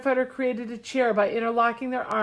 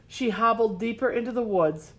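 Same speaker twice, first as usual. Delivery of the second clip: ordinary delivery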